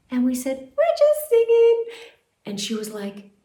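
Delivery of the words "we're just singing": put-on high, loud girlish chorus; innocent chirp. Girls answering mother, high innocent chirp.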